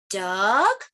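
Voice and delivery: DUCK drawn out with a rising question tune. Acted. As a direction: ask "Duck?", sliding up at the end. Book guesses at each bird.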